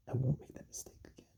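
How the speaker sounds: hushed mock-guilty aside; whispered confession. Comic confessing his own mistake, whispered aside. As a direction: whisper it as a private admission.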